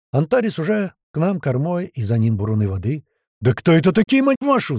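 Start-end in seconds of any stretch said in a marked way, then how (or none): 3.4-4.8 loud, high-pitched angry bellow; furious yell. Acted. Tuman curses at unknown attackers, yelling furiously. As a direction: explode with rage. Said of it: "заорал злющий Туман".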